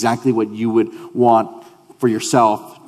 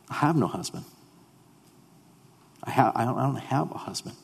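hushed evasive; 11 dB quieter, faster than usual. Acted. Woman's evasive answer.

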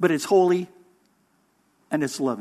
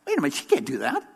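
scandalized onlooker; 5 semitones lower, 6 dB quieter, much faster than usual. Retold or imitated. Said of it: "It's sort of like".

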